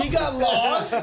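high, drawn-out exclamation; incredulous high holler; amused disbelief. Real, not acted. Amused incredulous echo of the story.

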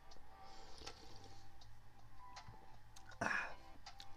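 long soft breathy sigh out; stoned narrator sighing mid story. Real, not acted. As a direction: let out one slow exhale.

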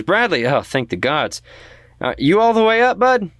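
high, fast relieved call up the stairs. Acted. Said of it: "She calls out".

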